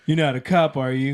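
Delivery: a nervous guy, voice lifted a little; mock-nervous, half laughing; nervous john. Mocking nervous john at sting.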